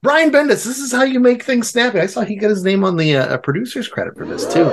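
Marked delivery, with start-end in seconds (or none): 0.0-2.0 high-pitched, worked-up scolding; mock indignation. Reviewer telling off other comic creators, in mock indignation. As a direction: scold them in a raised, exasperated pitch. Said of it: "it made me angry at other people".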